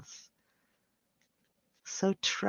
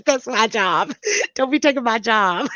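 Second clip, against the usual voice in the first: laughing; 3 semitones higher, 5 dB louder than usual. Real, not acted. Joking after own slip.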